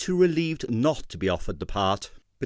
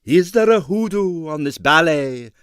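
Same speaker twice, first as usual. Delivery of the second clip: loud, higher headline cry; mock-dramatic headline; 4 semitones higher, 6 dB louder than usual. A narrator's reading. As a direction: boom out a lurid headline. Newspaper headline voiced.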